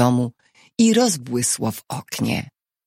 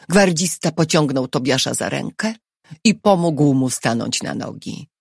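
ordinary delivery

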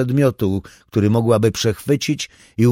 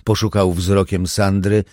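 ordinary delivery

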